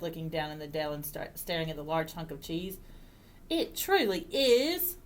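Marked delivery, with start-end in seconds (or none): 3.5-5.0 high, slow wifely agreement; drawn-out, high-pitched assent; farmer's wife agreeing. Acted. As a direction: agree slowly in a higher voice. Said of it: "said his wife".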